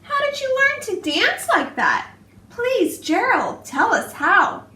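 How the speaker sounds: eager pleading. Acted. Animals begging Gerald eagerly.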